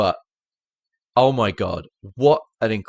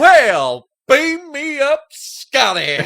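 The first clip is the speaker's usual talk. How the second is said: very high, hammed-up shout; mock senator exclamation; 8 dB louder, much slower than usual. Senator addressing the camera, loud and high mock exclamation. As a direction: belt it out as an over-the-top imitation.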